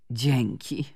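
plain reading voice

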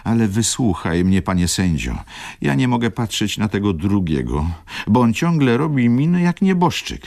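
a touch of tears as she pleads; mildly tearful pleading to the judge. A narrator's reading. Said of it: "zakończyła ze łzami".

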